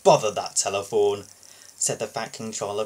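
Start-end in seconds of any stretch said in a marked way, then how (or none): 0.0-1.3 mildly cross, louder grumble at the phone; a touch of annoyed boss; frowning grumble. Acted.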